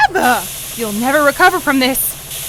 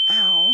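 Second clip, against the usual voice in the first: dazed cough; 2 semitones lower than usual. Acted. After the grenade explodes.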